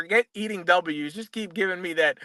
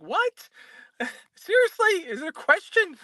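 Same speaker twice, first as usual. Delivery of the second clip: pitched way up, disbelieving; incredulous disbelief; 12 semitones higher than usual. Retold or imitated. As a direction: scoff like the question is absurd. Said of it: "I'm like".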